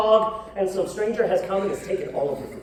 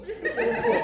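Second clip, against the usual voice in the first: mock high squeak. Shown as a demonstration. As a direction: say Stay in school in a much higher squeaky voice. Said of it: "repeated joke line".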